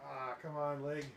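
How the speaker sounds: wincing through a leg cramp. Real, not acted. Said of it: "My leg's cramping pretty bad".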